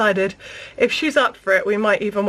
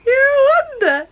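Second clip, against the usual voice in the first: much higher squeaky cooing repeat; 12 semitones higher, 4 dB louder than usual. Real, not acted. Second take, higher to baby.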